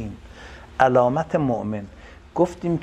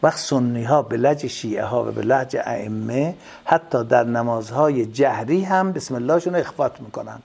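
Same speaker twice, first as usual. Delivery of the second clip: ordinary delivery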